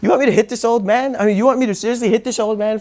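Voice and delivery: can't believe you want me to hit him; incredulous. Retold or imitated. Student asked to hit an old man, disbelieving. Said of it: "I was like".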